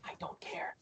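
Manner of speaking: very soft whispered brush-off; dismissive whisper. Acted. Eric brushes off his companion, whispering. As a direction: whisper it back, uninterested.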